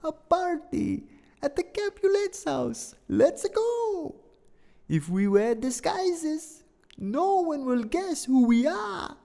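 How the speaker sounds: high, hammed-up Italian accent, slowing on the last lines; cartoon Italian, high and bouncy. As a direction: play Benvolio with a big put-on Italian accent. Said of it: "I do not claim to be an expert in accents".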